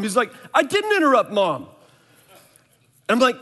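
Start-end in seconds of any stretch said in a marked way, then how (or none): 0.5-1.6 put-on higher, louder defensive kid; a boy shouting back that he did nothing wrong; son's protest, retold. Son talking back to his dad, loud and defensive.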